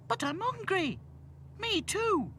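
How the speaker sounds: put-on very high child voices; whining twins. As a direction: pipe up with a child's complaint. Hungry twins complain.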